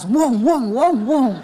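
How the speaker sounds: revving car engine noise. Retold or imitated. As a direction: rev the old engine. Husband revs up the ramp. (suara mesin digas)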